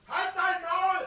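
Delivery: furious shout; berserk rage. Real, not acted. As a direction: bellow it at them. Shouting down hecklers.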